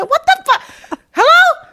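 laughing stunned squeal; shocked disbelief. Retold or imitated. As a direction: react like you can't believe your ears. Shocked that a kid sounds so grown.

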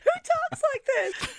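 high, slow laughing outcry; laughing shout. Real, not acted. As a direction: laugh it out in disbelief. Laughing at quote.

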